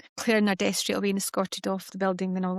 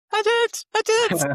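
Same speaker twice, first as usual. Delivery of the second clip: crying with joy; 13 semitones higher, 5 dB louder, slower than usual. Retold or imitated. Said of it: "burst out crying going".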